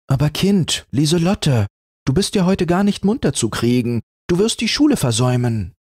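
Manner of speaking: a hint of a scolding mother; mother waking child.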